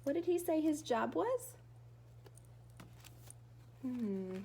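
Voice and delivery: plain reading voice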